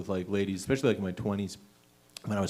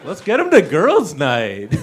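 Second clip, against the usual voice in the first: louder, slow, laughing glee; mock excited girls; 3 semitones higher, 9 dB louder, slower than usual. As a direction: sell the girls'-night invite. Women quoted excitedly.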